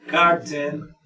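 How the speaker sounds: carton with the weight on CAR; first-syllable stress. Shown as a demonstration. Teacher shows where the voice force goes, stress on CAR in carton. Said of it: "mayor fuerza de voz en cart".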